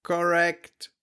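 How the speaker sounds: high, drawn-out computer announcement. Acted. Lie detector computer giving its verdict, computer voice. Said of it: "dann sagt der Computer".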